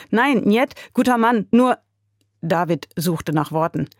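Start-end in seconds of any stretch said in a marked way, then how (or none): 0.1-1.8 higher, hurried protest, breaking off; urgent pleading. A narrator's reading. David defending his boss to the Russian, urgent pleading. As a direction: plead for him, grasping for words.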